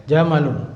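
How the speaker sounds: jamalun with a short, quick "ma"; short vowel. Shown as a demonstration. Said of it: "pendek ya".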